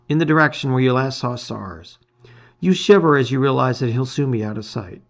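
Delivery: ordinary delivery, nothing marked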